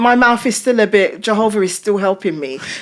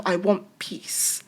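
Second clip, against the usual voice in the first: soft inner resolve; 10 dB quieter, slower than usual. Retold or imitated. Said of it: "if you say to yourself".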